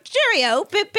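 high-pitched caricature British; mock British accent. Imagining a friend gone British, exaggerated mock British accent. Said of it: "she didn't start being like".

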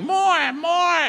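high, loud imitation of a heckler; mock yelling. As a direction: yell it like someone hollering from the back. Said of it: "Who was that yelling".

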